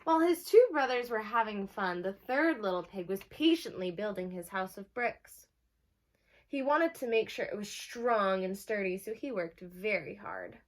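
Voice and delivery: lively read-aloud for a class; bright picture-book reading; reading to children. Acted. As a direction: announce the title like storytime. Teacher reads the story aloud.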